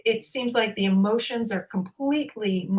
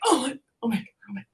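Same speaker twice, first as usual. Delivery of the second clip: higher, flustered repeated exclamation; mock-frantic, pitched up; retold freak-out; 3 semitones higher, faster than usual. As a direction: act out mild panic. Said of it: "freaking out".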